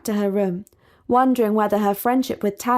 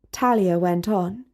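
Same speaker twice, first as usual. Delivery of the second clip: usual speaking voice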